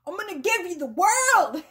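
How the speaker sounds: hammed-up high, loud pleading; insistent masculine. Masculine begging to give content woman the world, hammed-up insistence. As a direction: mock-desperate overblown protest, pitch shooting up. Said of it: "And he's like".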